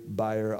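buyer said as BUY-er, two syllables; pronounced buyer. Shown as a demonstration. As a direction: say buyer with a long i, not beer. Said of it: "I'm gonna say buyer".

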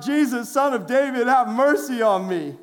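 urgent cry of a blind beggar. Acted. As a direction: call out to be heard over a crowd. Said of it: "And he cried out".

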